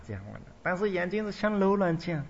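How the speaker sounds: normal talking voice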